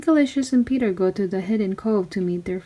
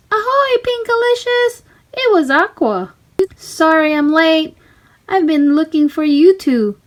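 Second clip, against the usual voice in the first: much higher, louder sweet call; mermaid calling up; 8 semitones higher, 5 dB louder than usual. Acted. Little mermaid calling to her friends on the ship, high cheerful call. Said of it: "Aqua called up".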